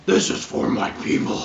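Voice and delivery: loud fierce war cry; battle cry. Acted. T Rex rider declares vengeance, loud and fierce. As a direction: roar it as you charge. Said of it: "Vizetnus is like".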